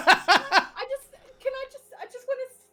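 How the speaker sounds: high, giddy stammered plea; hammed-up eager begging, tripping over the words; acted excitement. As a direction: beg in a high, overexcited voice. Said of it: "'cause she's real excited now".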